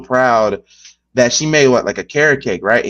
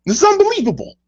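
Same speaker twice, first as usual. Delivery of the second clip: mock outburst. Imitating the host going off about raccoon mess, hammed-up. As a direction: stretch out the words in outrage. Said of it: "I'm like".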